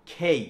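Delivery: খেই with a firm puff of air on kh; loud, aspirated খেই. Shown as a demonstration. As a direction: model খেই, kh gliding into ei. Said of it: "একটা খয়ের উচ্চারণ আসবে".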